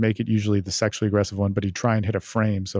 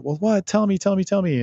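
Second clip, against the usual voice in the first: eager pleading; 10 semitones higher than usual. Retold or imitated. Voicing the eager target.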